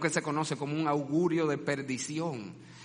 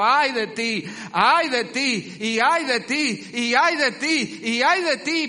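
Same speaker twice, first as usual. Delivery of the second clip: loud, high finger-pointing woe; a prophet condemning everyone, slow and loud; accusing prophet; 8 semitones higher, 8 dB louder, slower than usual. Acted. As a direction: point at each one and pronounce doom.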